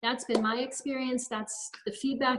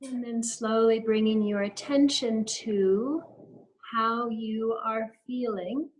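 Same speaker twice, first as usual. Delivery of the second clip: guided meditation. Acted. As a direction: lead them gently through each breath, unhurried. Leads a guided breathing practice.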